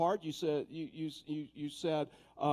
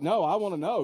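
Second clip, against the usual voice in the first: insistent mock demand; 4 semitones higher, 9 dB louder than usual. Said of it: "she's like".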